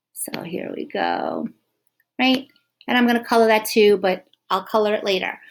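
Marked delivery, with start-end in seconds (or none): 0.1-1.5 whisper. Real, not acted. Teacher murmurs while drawing.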